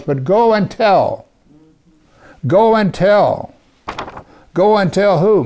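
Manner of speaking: high, loud, slow go and tell; emphatic command. Real, not acted. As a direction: preach go and tell with force. Preacher stressing the command.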